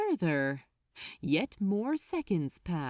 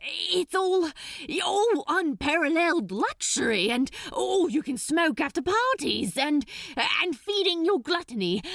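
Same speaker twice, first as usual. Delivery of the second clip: high, fast grasping list; desperate babble; 9 semitones higher, 4 dB louder than usual. Acted. Crowley listing excuses to the archdemon, fast and high. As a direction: pile up excuses frantically. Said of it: "grabbing at straws now".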